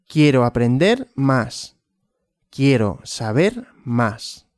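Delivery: normal talking voice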